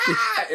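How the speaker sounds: high loud yelp of pain. Retold or imitated. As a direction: yelp as the bat hits. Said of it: "he's just like".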